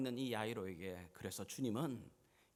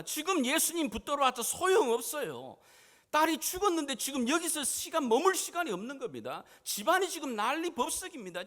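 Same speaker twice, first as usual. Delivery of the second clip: high, loud urgent protest; agitated servants; 12 semitones higher, 9 dB louder than usual. Acted. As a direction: pour out the objections at high pitch. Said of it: "주변에 지금도 여전히 종들이 말합니다". (종들처럼 높고 다급하게 따지듯)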